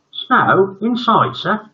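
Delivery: put-on very high, loud astonished boy; can't believe it; surprised boy.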